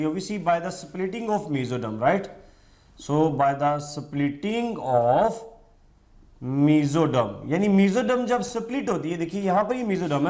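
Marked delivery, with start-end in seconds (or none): 3.0-7.3 slow dictation. Shown as a demonstration. Dictating notes to students.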